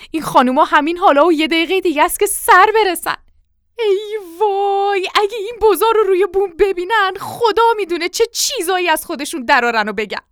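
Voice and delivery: high, loud panicky fretting; worked-up, dreading the embarrassment; flustered alarm. Acted. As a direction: wail in dismay, pitched high. Wife dreading the guests' gossip.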